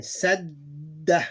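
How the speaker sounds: "sadda" with a held d and short final a; teacher's deliberate demo of double d in "sadda"; doubled consonant. Shown as a demonstration. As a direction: linger on the middle d of "sadda". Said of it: "these two become one".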